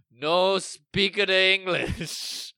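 high, very slow, loud mock foreign-accented chorus; class in broken English. Whole class answers the teacher in slow broken English. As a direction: drag it out like a confused class answering together. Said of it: "the whole class just goes".

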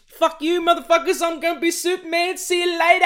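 high, full-volume yell; triumphant, hammed-up screamed send-off; gleeful parting shout. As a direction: shout a cocky farewell to the whole crowd. Imagined actor's triumphant exit outburst.

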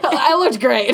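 loud laugh through the words. Real, not acted. Laughing at own joke.

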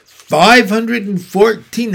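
high, loud and proud count. A narrator's reading. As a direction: announce the number proudly. Charlotte proudly answers.

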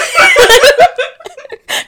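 loud high laughter. Real, not acted. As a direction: burst out laughing. Laughing at her own idea.

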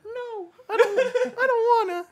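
high, slow, whimpering and laughing; whiny little voice. Pretend. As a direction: play a scared crying child. Voicing his scared penis.

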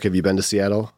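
ordinary delivery

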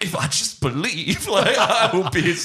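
high, drawn-out willing himself taller; mock-earnest wishing, stretched and laughing; imitated straining actor. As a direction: strain upward as if wishing hard. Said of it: "you could see him just being like".

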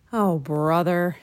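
slow, drawn-out grumble; exasperated mutter. A narrator's reading. Jack grumbles to himself as Annie disappears, muttering. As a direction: mutter it slowly to yourself. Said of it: "Jack muttered".

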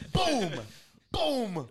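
high, laughing boom boom; cartoon punch noises, giggling; mock punch sound effects. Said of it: "like the boom sound effect".

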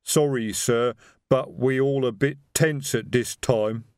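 lightly voiced slow troll; a troll explaining the tension, slowly. Troll explains to his boss.

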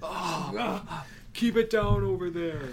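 high-pitched complaining neighbour voice; high annoyed neighbour. Pretend. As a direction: whine it up high like a fed-up neighbour. Mock neighbour scolding the couple.

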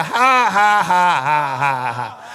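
loud, falling chain of fake laughs; demonstrated forced laugh. Shown as a demonstration. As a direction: belt out a deliberate laugh. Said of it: "So now start it. Learn it".

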